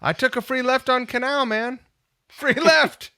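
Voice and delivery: high, loud, incredulous exclamation; amazed disbelief. Real, not acted. Driver telling host about empty Canal Street, amazed. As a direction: marvel loudly at the impossible.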